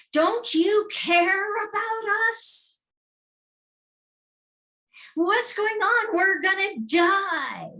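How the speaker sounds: high, loud, slow panicked plea; frightened shouting disciples; terrified cry. Acted. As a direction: cry out in terror to the sleeping master.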